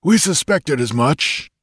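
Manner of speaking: a stern settler answering, slowly; frontier leader voice. A narrator's reading. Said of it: "answered the leader of the men".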